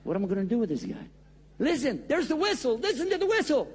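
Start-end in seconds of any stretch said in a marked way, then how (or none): 1.6-3.7 urgent pleading. Acted. Urgent plea about the train.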